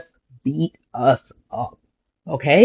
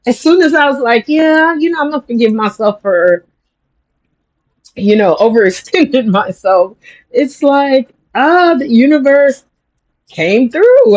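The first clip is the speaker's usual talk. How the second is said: excited; 9 semitones higher, 4 dB louder than usual. Real, not acted. Thrilled retelling of good luck.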